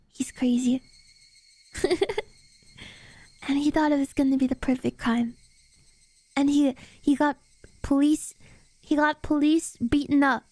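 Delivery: plain reading voice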